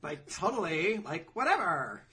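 put-on swooping, dismissive valley-girl parody. Co-host joining the joke, valley-girl parody. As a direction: draw out "totally" and "whatever". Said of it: "a fucking valley girl".

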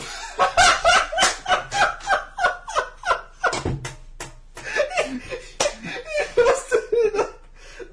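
shrieking high-pitched laugh; hysterical high laughter. Real, not acted. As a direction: laugh until you wheeze.

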